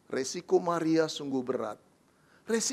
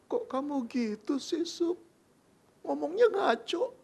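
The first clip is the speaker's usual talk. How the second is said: hurt protest; 8 semitones higher than usual. Acted. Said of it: "Lalu Maria".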